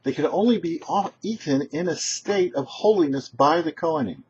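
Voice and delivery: usual speaking voice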